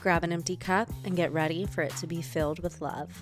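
ordinary talk, nothing marked

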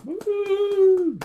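high excited whoop; cheering woo. Real, not acted. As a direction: whoop like a fan. Cheering in group chat.

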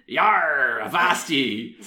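loud, high West Country pirate growl-word; hammed-up pirate impression. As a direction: call out like a mock pirate. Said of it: "and speaking like pirates".

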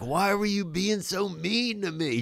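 high, drawn-out mock hurt; slow, plaintive high voice; high pleading complaint. Comic telling heckler off, high drawn-out complaint.

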